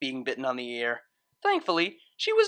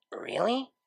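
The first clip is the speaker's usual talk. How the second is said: small, hesitant voice; 7 semitones higher, 6 dB quieter than usual. A narrator's reading. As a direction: ask it softly, feeling exposed. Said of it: "she asked in a small voice".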